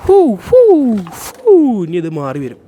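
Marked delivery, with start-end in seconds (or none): 0.1-1.5 loud, high hooting whoops; playful high hoots as a transition effect; hooting transition sound. Pretend. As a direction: hoot high and loud like a jingle for the cut. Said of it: "before transition എടുക്കട്ടെ".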